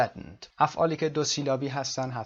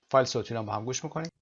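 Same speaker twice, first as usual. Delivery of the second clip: ordinary talk, nothing marked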